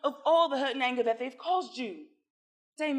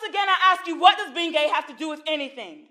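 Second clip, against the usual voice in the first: louder, pressing the question home; insistent; 3 semitones higher, 8 dB louder than usual. Acted. Therapist pressing Damon, loud and insistent. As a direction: drive the point home, loud and firm.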